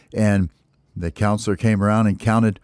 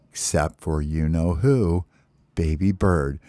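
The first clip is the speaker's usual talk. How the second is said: ordinary delivery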